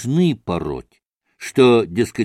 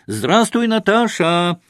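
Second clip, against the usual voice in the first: a cheeky greeting called out higher; calling out; 9 semitones higher than usual. A narrator's reading. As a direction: hail the market woman from afar. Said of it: "крикнул он". (весёлый окрик)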